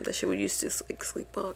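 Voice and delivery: drops to an unvoiced whisper. Real, not acted. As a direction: whisper it confidentially. Softening voice telling about sister.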